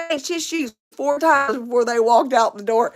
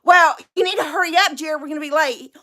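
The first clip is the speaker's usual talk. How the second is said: higher, hurried bickering wife; mock nagging; 5 semitones higher, faster than usual. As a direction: nag him to get moving. Acted quarrel, wife hurries husband.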